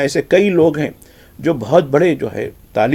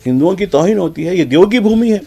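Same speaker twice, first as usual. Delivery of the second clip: ordinary delivery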